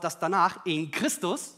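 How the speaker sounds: ordinary talk, nothing marked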